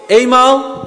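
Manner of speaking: going once, called out; auctioneer. Real, not acted. (veilingmeester die afroept)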